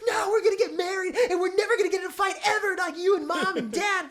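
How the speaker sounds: high, loud, mock-crying kid rant; six-year-old tantrum. Teenage character arguing about marriage, hammed-up childish tantrum. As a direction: imitate a child wailing in protest. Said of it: "him having a temper tantrum as a six-year-old".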